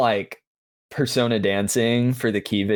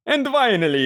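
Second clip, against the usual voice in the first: higher strained Count announcing; Count voice again; 10 semitones higher than usual. Acted. Host retrying the plug, straining in the Count voice. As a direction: push the Count through a sore throat. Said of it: "this is kind of hurting my throat".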